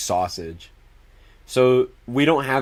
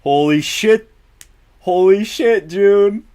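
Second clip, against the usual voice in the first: sudden much higher, louder outburst; shocked; 9 semitones higher, 7 dB louder, slower than usual. Real, not acted. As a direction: gasp it out in real disbelief. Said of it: "sudden shock at something".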